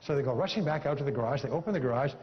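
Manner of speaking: ordinary talk, nothing marked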